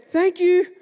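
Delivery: high, loud mock thanks from the neighbour; imitated neighbour lady. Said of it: "And she said".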